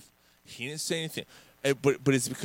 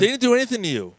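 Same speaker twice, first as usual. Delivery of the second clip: raised voice, pushing hard; heated outburst; 7 semitones higher, 6 dB louder than usual. Real, not acted. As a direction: say it like you've had enough. Heated rant at the player.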